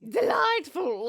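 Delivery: high, loud, drawn-out royal delight; slow, grand mock-regal approval; playful queen. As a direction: stretch it out like a thrilled queen. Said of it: "a meal for the queen".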